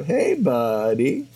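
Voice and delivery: drawled, swooping slacker catchphrase; Pauly Shore. Acted. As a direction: stretch it very slowly, pitch dipping then sliding up. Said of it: "and Pauly Shore".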